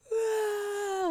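loud, high mock wail; imitated bawling. Coach re-enacting her breakdown in the car, bawling. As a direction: burst into a long, exaggerated crying howl.

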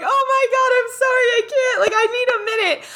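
high, hurried mock panic; hammed-up pleading, close to tears; imitated breakdown. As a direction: imitate a friend falling apart in a rush. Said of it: "actually having a breakdown".

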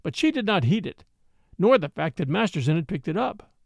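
usual speaking voice

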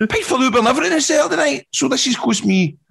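can barely get the words out for laughing; laughing through it. Retold or imitated. Retelling how the night cost him, giggling high and fast.

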